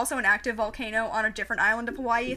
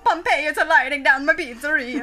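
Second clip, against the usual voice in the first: loud laughing cartoon Italian, a bit higher; mock Italian accent; 3 semitones higher, 8 dB louder than usual. Joking about Pompeii burning, laughing mock Italian accent. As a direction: cry it like a panicked pizza chef through laughter.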